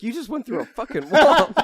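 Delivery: loud, high-pitched retold disbelief breaking into laughter; incredulous shout. Host retelling a film character's reaction to the crash, incredulous shout.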